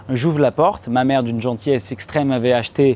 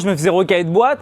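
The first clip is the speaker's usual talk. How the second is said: ordinary talk, nothing marked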